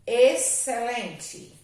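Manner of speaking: Excelente slow and loud, x silent. Shown as a demonstration. Teacher saying Excelente to show x is silent. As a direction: say Excelente slowly without an x sound. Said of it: "não tem valor fonético".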